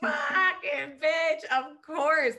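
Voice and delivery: high, loud, drawn-out retold outcry; exasperated realization. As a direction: cry it out as if floored by the obvious. Acts out collapsing at a revelation.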